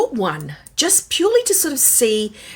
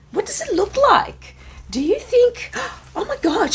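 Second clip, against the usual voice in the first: excited gasp. Acted. Acted excited shopper skit opening.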